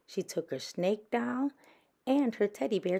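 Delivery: ordinary delivery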